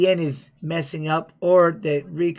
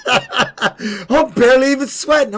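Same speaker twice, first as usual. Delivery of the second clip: laughing; 6 semitones higher, slower than usual. Real, not acted. Laughs while repeating his own quote.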